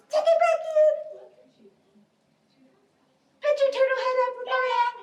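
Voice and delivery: very high, squeaky little voice; tiny turtle voice. Pretend. Teacher playing turtles with children, tiny high voice. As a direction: shoot the pitch way up and hold it there.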